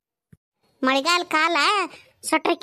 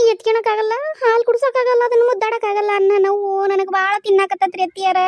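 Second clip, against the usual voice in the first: raised, crying voice that begs her mother-in-law; breaking into tears while pleading; tearful plea; 4 semitones higher, 3 dB louder than usual. Acted. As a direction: sob out the grief, pushing the words louder. Daughter-in-law grieving over baby.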